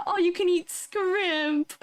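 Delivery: high, slow announcer hype; mock hype. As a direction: sell it like a cheesy ad. Hyping fake menu.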